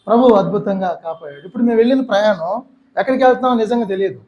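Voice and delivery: ordinary talk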